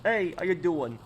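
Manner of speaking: exaggerated New York greeting in a New York accent. Shown as a demonstration. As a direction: lay the New York accent on heavier, like a street guy. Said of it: "I can also do, like, a, uh, a deeper New York accent".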